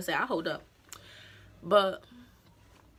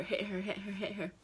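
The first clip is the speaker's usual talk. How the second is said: ordinary talk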